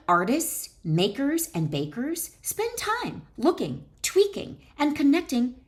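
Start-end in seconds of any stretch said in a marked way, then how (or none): 2.4-5.6 emphatic high list. Acted. Reads list, then repeats it.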